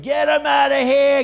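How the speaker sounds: loud, slow yelled order; retold scream to eject protester. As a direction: yell it out. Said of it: "So I'm screaming".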